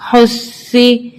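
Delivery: حسی very slow with the ص held long and firm; doubled sad. Shown as a demonstration. Teacher shows children how to double the ص, slowly. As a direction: lean on the doubled ص in حسی and draw it out. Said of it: "ٹو ٹائمز پڑھیں گے".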